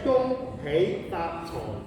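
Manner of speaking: slow, separated syllables for learners; slow demonstration. Shown as a demonstration. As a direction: say the phrase very slowly. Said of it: "gonna teach you how to say".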